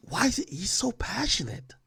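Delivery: "why is it he's so passionate" whispered, no voice. Real, not acted. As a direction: lean in and whisper it like a secret. Echoes his earlier question.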